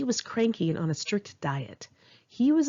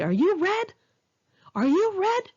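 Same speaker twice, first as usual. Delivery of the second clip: high teasing falsetto of the cook; mocking cook; 13 semitones higher, 3 dB louder than usual. Cook teasing the waitress over a wrong order, high falsetto mockery. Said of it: "he'd say".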